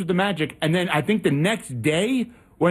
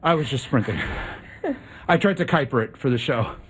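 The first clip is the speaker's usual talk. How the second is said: out of breath; 3 semitones lower, faster than usual. Real, not acted. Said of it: "you'd be so out of breath".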